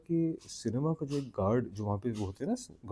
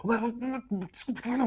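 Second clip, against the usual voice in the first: very high-pitched, hammed-up begging; desperate pleading; 12 semitones higher, slower than usual. Pilot friend begging the laughing storyteller to explain, desperate. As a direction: squeal it as a comic plea.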